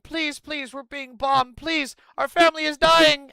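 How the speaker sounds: high pleading voice imitating desperate people; mock pleading. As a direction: beg like your family is dying. Pleading Palestinians quoted.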